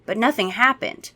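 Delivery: ordinary delivery, nothing marked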